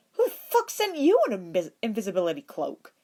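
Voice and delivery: shocked. A narrator's reading. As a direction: blurt it in disbelief. Ed shocked by invisibility cloak.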